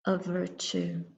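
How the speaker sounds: normal talking voice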